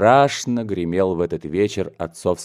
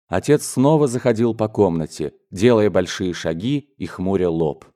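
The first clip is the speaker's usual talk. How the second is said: ordinary delivery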